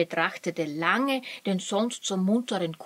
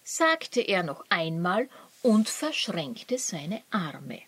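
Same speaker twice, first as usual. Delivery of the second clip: usual speaking voice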